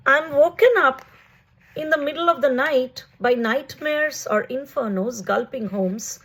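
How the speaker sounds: measured, lifted poetry-reading voice, pausing between phrases; poem reading. Acted. Poet reading a prose poem to online friends, lyrical and measured. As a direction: read it like verse for an audience.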